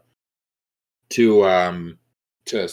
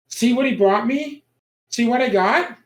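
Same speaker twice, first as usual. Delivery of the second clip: put-on high, eager child voice; a creepy little girl showing off her doll; 12 semitones higher, 3 dB louder than usual. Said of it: "she turns and she looks at you all".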